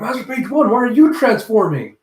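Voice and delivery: high, loud startled outcry; mock alarmed question. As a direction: question the boss in a panic. Alarmed minions questioning their master.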